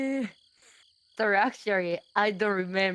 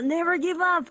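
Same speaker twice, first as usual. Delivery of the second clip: rousing cheer; 7 semitones higher, faster than usual. Real, not acted. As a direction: shout it to fire the class up. Cheering on language learners.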